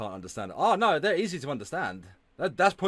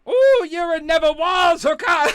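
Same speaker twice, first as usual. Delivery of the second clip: very high, loud falsetto jeer; scandalized, high-pitched teasing; gleeful mock taunt; 14 semitones higher, 9 dB louder than usual. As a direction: squeal the insult with relish. Relishing the insult in mock voice.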